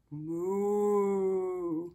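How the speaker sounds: drawn-out cow moo. Shown as a demonstration. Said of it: "What does the cow say?".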